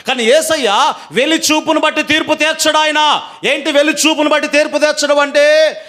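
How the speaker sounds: high, driving sermon delivery; preaching rebuke. Real, not acted. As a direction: challenge the listeners with pointed questions. Rebukes congregation from the pulpit. (గద్దిస్తూ ప్రసంగం)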